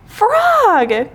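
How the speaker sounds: high, drawn-out call; crying out. A narrator's reading. Toad reaching Frog on the island, crying out. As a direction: call out urgently to a friend. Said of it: "cried Toad".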